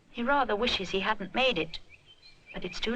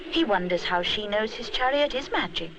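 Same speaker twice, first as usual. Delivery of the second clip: ordinary delivery, nothing marked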